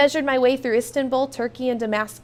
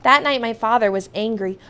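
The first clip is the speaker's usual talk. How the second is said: plain reading voice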